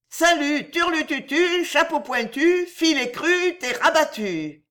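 high singsong nonsense; high-pitched taunting refrain; drunken rhyming. A narrator's reading. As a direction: chant the silly rhymes tipsily. Drunk woman chants rhyming nonsense.

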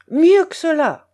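loud, high shouted order; commanding. A narrator's reading. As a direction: drive the rower on. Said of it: "commanda Tchelkash".

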